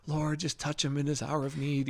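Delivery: soft, hammed-up churchy prayer; mock pious prayer. Mocking people praying over him, soft and pious. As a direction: imitate well-meaning church people praying. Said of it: "people are coming over and laying hands on me and being like".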